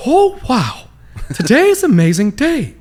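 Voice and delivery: high, loud, giddy cartoon hero; Will Ferrell. Shown as a demonstration. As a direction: beam like a goofy cartoon hero. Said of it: "where I learned Will Ferrell".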